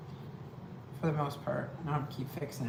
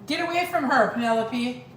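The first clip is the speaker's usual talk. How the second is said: yelling at the dog; 7 semitones higher, 3 dB louder than usual. Real, not acted.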